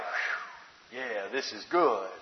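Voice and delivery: soft breathy sigh of relief, then easy contentment; lightly voiced Jonah, relieved; Jonah's relieved sigh. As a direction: let it out like finally sitting in the shade. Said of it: "Jonah is like".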